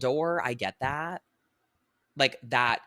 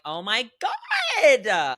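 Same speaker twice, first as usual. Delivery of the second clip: long, high mock wail of exasperation; loud, drawn-out overdramatic groaned words; exasperated; 9 semitones higher, 7 dB louder, much slower than usual. Podcaster reacting to crazy TikTok dating advice, exasperated.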